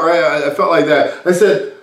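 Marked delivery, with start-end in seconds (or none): none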